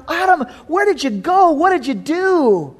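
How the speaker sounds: loving call. Acted. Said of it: "a love in his call".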